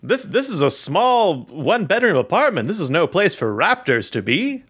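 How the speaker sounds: put-on high old Hollywood transatlantic voice; glamorous 1940s star, clipped vowels; transatlantic accent. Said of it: "a transatlantic accent".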